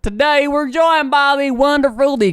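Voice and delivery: hammed-up Texan show announcer; big, high mock-Texas introduction; Texan accent. Host introduces the streamer guest in a hammed-up Texan accent. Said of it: "in Texas with that accent".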